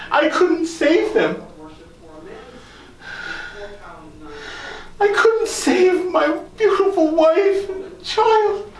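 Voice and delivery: high, slow, tearful wail; sobbing. Acted. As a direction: break down crying over the loss. Grieving loss of wife, child.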